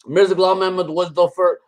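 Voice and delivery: imitating Damon's accent, lightly put on; mock accent. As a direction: slip into his accent for the name. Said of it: "he's got that accent".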